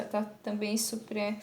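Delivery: usual speaking voice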